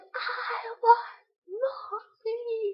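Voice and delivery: put-on high, loud, drawn-out toddler wail; hammed-up crying for a parent; imitating tearful wail. Tearful child wanting mommy at bedtime, mock sobbing wail. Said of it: "tears pouring down her face".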